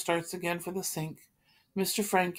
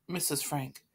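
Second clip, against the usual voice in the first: ordinary delivery, nothing marked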